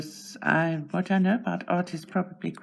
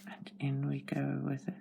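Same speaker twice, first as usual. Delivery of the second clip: whispering; 8 dB quieter than usual. Real, not acted. Whispering while coloring.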